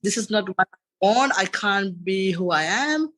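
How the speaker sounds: ordinary delivery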